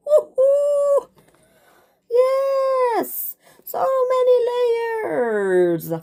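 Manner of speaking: high, squealing whoop of delight, drawing out the last words; a delighted high-pitched celebration, stretching out so many layers; gleeful whoop. Acted. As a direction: cheer yourself on, thrilled and giddy. Cheering over her infinite layers.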